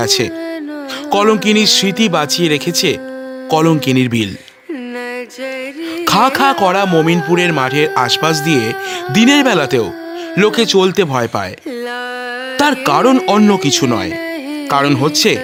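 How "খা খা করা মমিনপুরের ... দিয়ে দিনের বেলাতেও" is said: ordinary delivery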